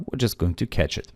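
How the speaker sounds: ordinary delivery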